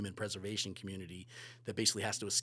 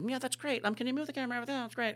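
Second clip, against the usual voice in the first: much higher, airy mock of a hands-off director; mocking a lazy director; 13 semitones higher, faster than usual. Mocking the hands-off director, much higher mock voice. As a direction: sneer at the director who just sits back and praises. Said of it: "I wouldn't sit back and go".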